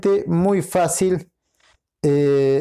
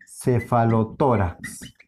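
cefalotórax low and slow, piece by piece; slow word demonstration; 6 semitones lower, slower than usual. Shown as a demonstration. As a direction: spell out cefalotórax by ear, slowly, in a lower pitch. Teacher slowly presents the compound word. (cefalotórax despacio y en tono bajo, por partes)

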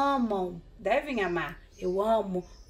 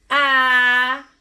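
"abacate" loud and drawn out, a... ba... ca... te; syllable by syllable; 3 semitones higher, 9 dB louder, slower than usual. Shown as a demonstration. Teacher introducing a new word to pupils, syllable by syllable. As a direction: hold each syllable of "abacate", pausing between. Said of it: "pra gente identificar as sílabas".

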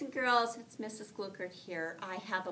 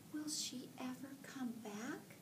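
worried mother duck, whispered; 5 semitones higher, 7 dB quieter than usual. Acted. Mother Duck watching Ruby fly away, worried whisper. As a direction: breathe the question out anxiously.

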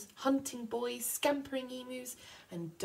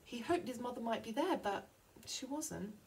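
ordinary delivery, nothing marked

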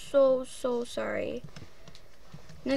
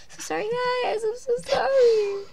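high, whiny pretend sob; a hammed-up tearful sorry; mock crying apology; 10 semitones higher than usual. Real, not acted. Apologizing for lost footage.

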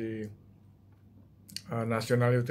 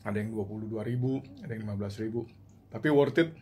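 biting while talking; muffled chewing speech; mouth full of bread. Real, not acted. Seen 2: Bread pressed into mouth, biting, through all labelled frames.

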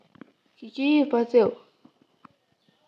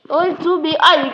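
normal talking voice

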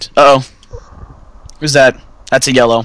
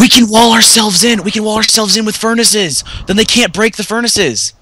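excited; 6 semitones higher than usual. Real, not acted. Sudden idea, excited shouting.

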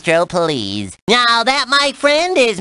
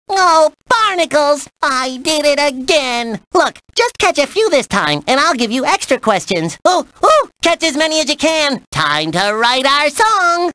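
perky, showy cartoon character; cartoon game host; 4 semitones higher than usual. Host briefs the player on writing a song, cartoon game host. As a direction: present it like a playful cartoon host.

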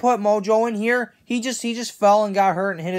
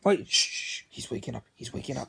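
urgent low whisper; very soft, secretive alert; hushed warning; 8 semitones lower, 11 dB quieter, faster than usual. Acted. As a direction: shush them and warn under your breath. Hushing others as Mojo wakes.

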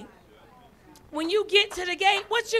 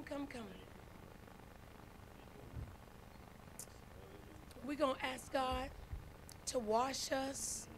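soft altar call; 4 semitones lower, 5 dB quieter than usual. Acted. Pastor inviting the church to the altar to repent, softly. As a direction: gently call them forward to the altar.

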